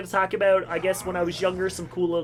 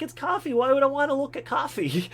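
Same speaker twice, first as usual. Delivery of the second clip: put-on high, dismissive little boy; high kid shrugging off coffee; 7 semitones higher than usual. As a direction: brush it off like a bored kid.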